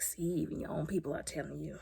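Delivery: unvoiced told-you-so whisper; soft whispered remark; whispered aside. Real, not acted. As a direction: whisper it smugly.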